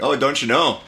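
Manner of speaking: put-on Canadian accent. Shown as a demonstration. Friend showing the accent from the Czech bar, mock Canadian. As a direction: mimic a stock Canadian phrase. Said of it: "It was a Canadian accent".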